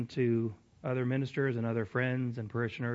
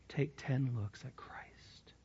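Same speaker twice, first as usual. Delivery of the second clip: slow whispered emphasis; whispering; 9 dB quieter, slower than usual. Real, not acted. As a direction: whisper it slowly, weighty. Emphatic hushed repeat of quote.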